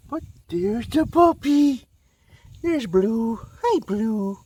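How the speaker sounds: high, slow cooing dog talk. Real, not acted. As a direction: coo at the dog sweetly. Greeting the dog Blue.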